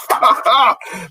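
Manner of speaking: high-pitched burst of laughter; laughing. Real, not acted. Host reacting to Terry's comment in the chat, high laughter.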